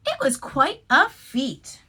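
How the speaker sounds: ordinary delivery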